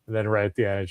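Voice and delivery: normal talking voice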